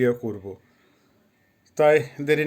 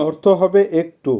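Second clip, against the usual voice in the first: ordinary talk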